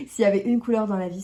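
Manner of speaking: fast, giggling through the words; laughing. Real, not acted. Mother laughing alone at her camera over her favourite colour, giggling. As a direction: laugh at your own joke as you say it. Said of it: "je rigole toute seule devant ma caméra".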